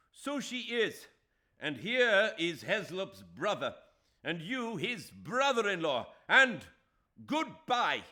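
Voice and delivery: loud, high, furious farewell; furious shout. A narrator's reading. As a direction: hurl it at him in rage. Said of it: "Shame turned into rage".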